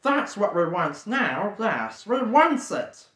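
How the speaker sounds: slow, longing murmur; a hint of Gollum yearning; lightly voiced Gollum. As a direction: crave the ring.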